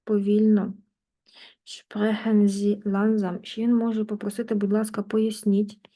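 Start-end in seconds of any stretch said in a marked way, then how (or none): 1.6-3.4 slow, deliberate German, each word apart; slow demo of langsam. Shown as a demonstration. Teacher showing students how to ask for slower speech, slowly. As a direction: speak it slowly as you'd ask others to. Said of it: "говоріть повільніше".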